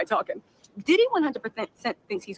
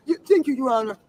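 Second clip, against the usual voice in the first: meek [name]; 4 dB louder than usual. Retold or imitated. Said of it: "talking like that".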